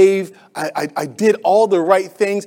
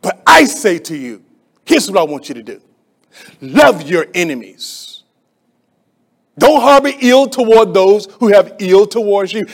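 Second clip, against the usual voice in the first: commanding authority; 7 dB louder than usual. Retold or imitated. Preacher voicing Jesus commanding love for enemies. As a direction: proclaim it as a command. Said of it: "he says it with authority".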